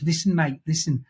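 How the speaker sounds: a light imitation of an overdone Scouse accent; mock Scouse threat. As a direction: ham up a phlegmy Scouse menace. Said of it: "takes the piss out of him, his own accent".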